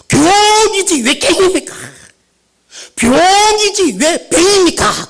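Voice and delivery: "경이지 왜 갱입니까"를 높고 크게 느릿느릿 따지기; 장로 흉내로 "경"과 "갱"을 과장해 대비; mocking correction. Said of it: "늘 그냥 갱갱 그러거든". (목사 사투리를 나무라는 장로의 높고 큰 항의)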